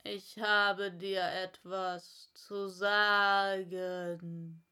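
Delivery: slow caterpillar; slow, inviting caterpillar; Raupe. Acted. Raupe voiced. (langsame, einladende Raupe)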